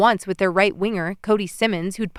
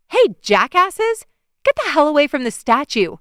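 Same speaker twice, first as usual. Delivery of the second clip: high, loud angry yell; 7 semitones higher, 5 dB louder than usual. A narrator's reading. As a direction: shout them off the statue. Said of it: "I yelled".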